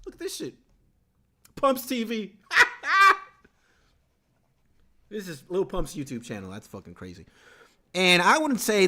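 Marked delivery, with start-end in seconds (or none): 2.5-3.2 high laugh. Real, not acted. Laughing at [name] channel.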